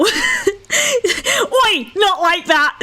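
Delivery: high-pitched protest through giggles; imitated indignant yelp, laughing; laughing mock protest. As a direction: squeal it while cracking up. Retold chat reply, protesting through laughter.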